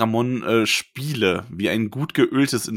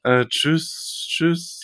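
walking away; 4 semitones higher, much slower than usual. Pretend. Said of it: "ich bin immer weiter weg".

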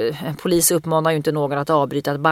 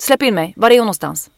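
louder, flat-hard police command; robotic cop voice; 3 semitones higher, 6 dB louder than usual. Retold or imitated. She pushes the man to let her in, in cop voice. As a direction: bark it like an unbending robot cop. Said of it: "jag är fan en jävla robot".

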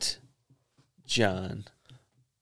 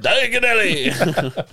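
excited; 9 semitones higher, 4 dB louder, slower than usual. Real, not acted. Excited shout of name.

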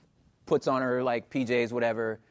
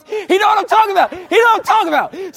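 much higher, louder, fast call-out; excited shout; 8 dB louder, faster than usual. Real, not acted. Comedian calling out a physics fan in the crowd, shouting. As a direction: point him out gleefully. Hyping an audience member.

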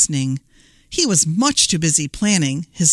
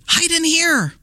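high, mildly alarmed piglet voice; a touch of storybook urgency; urgent cry; 10 semitones higher than usual. A narrator's reading. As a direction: hurry them inside, pitched high. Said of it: "cried the third little pig".

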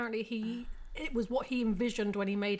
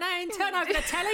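quick, loud, high Cockney on the edge of laughter; mock Cockney; 10 semitones higher, 6 dB louder, faster than usual. Joking about the model and the telly, mock Cockney shout. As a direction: holler it in hammed-up Cockney. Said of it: "She's a Cockney".

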